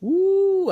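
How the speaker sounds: high, loud drawn-out ooh; long high "ooh". Real, not acted. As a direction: let out a long, high ooh before answering. Asked a hard question, reacts.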